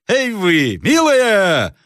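high, drawn-out coachman's call; calling to horses. Acted. Coachman calls to his horses. (протяжный окрик ямщика)

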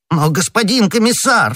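high-pitched protest; agitated appeal, pitch jumping up; crying out. A narrator's reading. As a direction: cry out to interrupt the official. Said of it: "вскричал Бонасье".

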